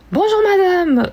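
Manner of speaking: high, loud, drawn-out greeting; bank phone greeting. Acted. Bank call skit about blocking a card, exaggerated high greeting. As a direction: sing out a hammed-up high hello. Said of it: "qui est au téléphone avec sa banque".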